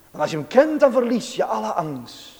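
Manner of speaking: normal talking voice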